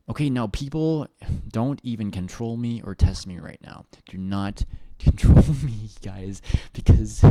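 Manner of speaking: loud mock warning to chat, laughing through it; half-joking loud scold at viewers; mock-stern loud. Real, not acted.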